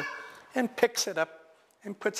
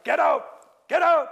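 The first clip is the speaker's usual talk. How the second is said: much higher, louder frantic shout; panicked yell; 11 semitones higher, 8 dB louder than usual. Acted. Cake to his slaves as octopus grows, frantic yell. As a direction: scream at everyone to flee.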